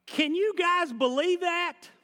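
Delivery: high, loud, slow amazed cry; Jesus raising his voice. Acted. Preacher voicing Jesus amazed at the crowd, high and loud. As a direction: voice Jesus astonished, calling out to the crowd. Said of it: "I think he raised his voice".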